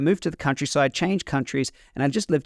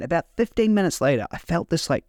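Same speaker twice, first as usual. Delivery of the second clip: usual speaking voice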